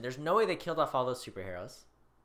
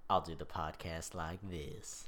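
low, slow Southern drawl; Southern accent; 8 semitones lower, 6 dB quieter, slower than usual. Shown as a demonstration. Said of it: "You just went Southern".

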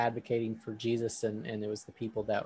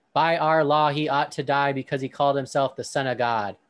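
forceful reply; 3 semitones higher, 8 dB louder than usual. Acted. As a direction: punch the opening louder, then ease into reading. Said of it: "responded with force".

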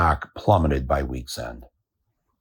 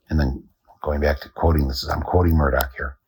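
usual speaking voice

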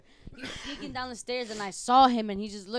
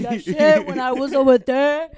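louder, slower bragging voice; mock Joseph boasting; 4 semitones higher, 12 dB louder than usual. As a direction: ham up a proud stoner boast.

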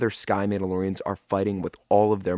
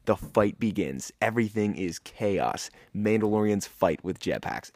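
ordinary delivery

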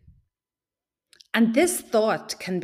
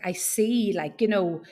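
ordinary talk, nothing marked

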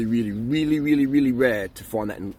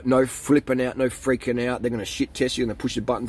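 usual speaking voice

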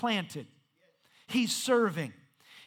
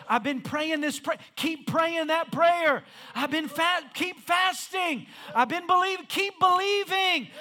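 rally the congregation, louder and higher each time; rousing pulpit exhortation at a raised pitch; full-force preaching; 8 semitones higher, 6 dB louder than usual. Acted.

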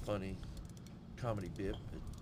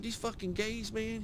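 very high-pitched comedian imitation; Ralphie May; 11 semitones higher than usual. Retelling a comic's routine, high mimicked voice. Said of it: "He said".

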